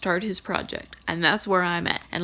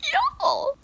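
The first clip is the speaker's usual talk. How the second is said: excited call. Real, not acted. Reader announces finishing the book, an excited high call. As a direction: burst in excited.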